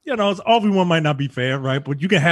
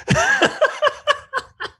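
high laughter. Real, not acted. Laughing at the misquoted villain line, high burst of laughter.

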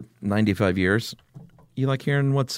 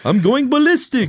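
camp it up as a vampire, way up high and loud; Tom Cruise vampire impression; 6 dB louder than usual. Co-host mocks Tom Cruise's vampire accent, hammed-up and high. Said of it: "I think he talked like this".

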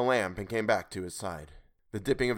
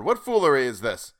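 loud, higher challenge; calling out; 5 semitones higher, 8 dB louder than usual. A narrator's reading. Sailor challenging whoever is out there, calling out. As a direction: demand it across the water. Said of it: "I called".

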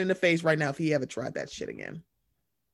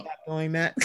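ordinary talk

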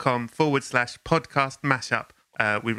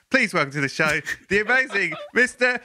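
hammed-up announcer; 9 semitones higher than usual. Host introduces the superfan guest, hammed-up ringmaster announcement. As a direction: bellow the guest's name like a circus ringmaster.

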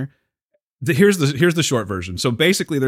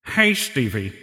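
failed Sammy Davis impression; 4 semitones higher than usual. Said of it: "trying to do a [name]".

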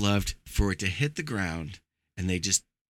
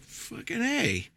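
much higher, slow, drawn-out cheer; mock enthusiastic crew; 5 dB quieter, much slower than usual. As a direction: stretch it out high and slow like a gleeful sidekick. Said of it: "they're like".

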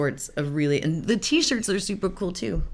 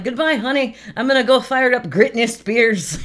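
higher, louder cheery send-off; snowplow driver heading out; 6 semitones higher, 6 dB louder than usual. Acted. Driver calls to spouse before taking the plow, raised voice. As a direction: call goodbye on the way out the door. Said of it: "you're like".

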